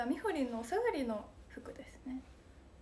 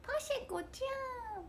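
much higher, soft call of a name; impression; 13 semitones higher, 7 dB quieter than usual. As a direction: call sweetly in someone else's voice. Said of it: "みたいな".

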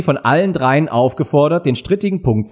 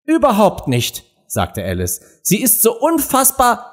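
empörtes Mädchen; 10 semitones higher than usual. A narrator's reading. Alice empört über Königin.

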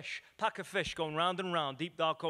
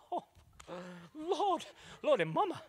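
high, swooning cry of Lord; smitten exclamation; 9 semitones higher than usual. Retold or imitated. Lovestruck young man swooning at how she walks. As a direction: overcome by her walk, gasping it out high. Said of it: "I'm like".

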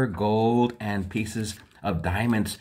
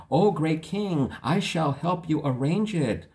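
fawning cat; 7 semitones higher than usual. Acted. Cat answers the king.

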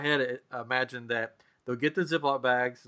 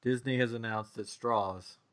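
Tennessee accent; 7 dB quieter than usual. Real, not acted. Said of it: "that's my Tennessee accent coming out".

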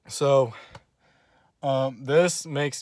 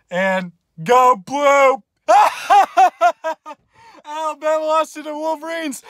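gloating cheer; 13 semitones higher, 8 dB louder than usual. Real, not acted. Fan signing off by cheering Michigan, high gloating shout and laugh. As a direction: cheer and gloat one last time.

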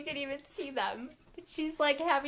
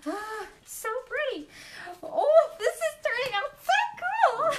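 much higher and louder, squealing with delight; thrilled; 11 semitones higher, 4 dB louder than usual. Real, not acted. Painter shows her live viewers the pour, thrilled squeal. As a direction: gush over the painting like it's magic.

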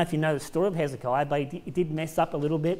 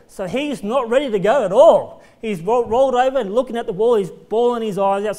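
louder, higher, pressing the point home; rising preacher emphasis; 4 semitones higher, 8 dB louder than usual. Real, not acted. Preacher raising his voice to the congregation about Hezekiah. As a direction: builds the story up with force.

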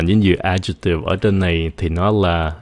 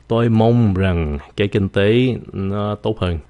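ordinary delivery

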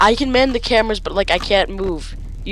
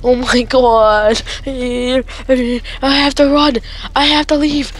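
panicked wail; 3 semitones higher than usual. Real, not acted. Player desperate to run and leave, panicked wail. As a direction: rise from moaning to frantic cries.

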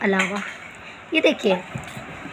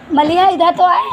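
raised, higher call across the room; 7 semitones higher, 5 dB louder than usual. Real, not acted. Mother calling her daughter Maliha to come over, raised.

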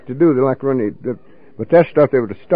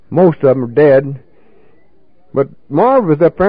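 ordinary delivery

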